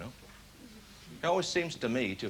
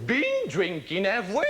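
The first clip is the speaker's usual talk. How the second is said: slow, higher, louder accusing drawl, face right in close; slow nosy police questioning; suspicious copper; 8 semitones higher, 5 dB louder, slower than usual. Retold or imitated. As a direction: lean in and sniff out the drink. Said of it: "Have you ever tried talking like that?".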